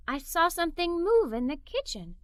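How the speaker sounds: ordinary delivery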